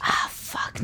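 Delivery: under-the-breath curse; whispered curse. Real, not acted. Frustrated, blanking on an answer.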